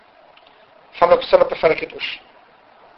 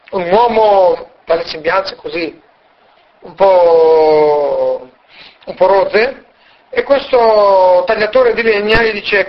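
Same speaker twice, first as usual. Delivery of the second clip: usual speaking voice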